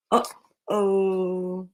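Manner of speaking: low, soft worried uh-oh. A narrator's reading. Warning the listening kids, softly worried. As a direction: let worry creep in, soft and low. Ominous aside in story.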